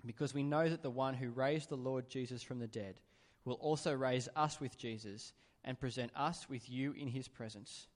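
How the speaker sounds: normal talking voice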